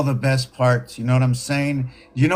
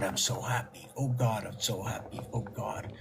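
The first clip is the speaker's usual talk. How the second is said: fading to a whispered, much softer sigh of bliss; breathy whisper; 4 semitones lower, 14 dB quieter than usual. Retold or imitated. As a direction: breathe it out until it is nearly silent. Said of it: "Why is it".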